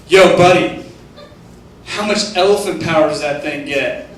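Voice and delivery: loud cocky bro call-out; brash rich guy. Retold or imitated. Ferrari guy taunting the man in the Jetta, cocky. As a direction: shout it across like a showoff driver. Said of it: "he looks over at me and goes".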